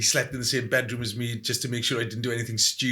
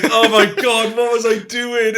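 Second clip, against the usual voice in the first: laughing disbelief; 10 semitones higher, 7 dB louder than usual. Retold or imitated. Recalls own shame, laughing.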